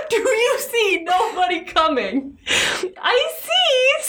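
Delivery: high, slow, desperate wail; frantic cry. Acted. Reader voicing the wife crying to her sister in a high frantic wail. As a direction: stretch it out like she's losing it. Wife's high pleading question.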